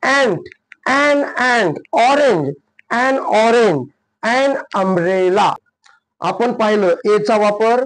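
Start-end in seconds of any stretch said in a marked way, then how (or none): 0.0-3.9 1 slow model words. Shown as a demonstration. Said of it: "teacher models article". 4.2-5.5 1 slow model phrase. Shown as a demonstration. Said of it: "teacher models article".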